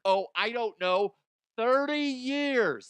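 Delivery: high, loud blurted answer; eager contestant yelling the answer; mock game show answer. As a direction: shout it out like a quiz contestant. Mock quiz answer shouted high.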